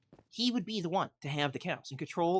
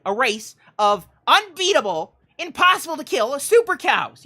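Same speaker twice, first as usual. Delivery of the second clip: mock epic build; 8 semitones higher, 10 dB louder than usual. Building to mock villain plan.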